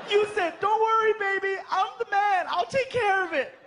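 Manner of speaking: high, indignant mock girlfriend; a high shrill-voiced mock mom; angry girlfriend. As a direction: scold like a protective mother. Girlfriend mocking his words.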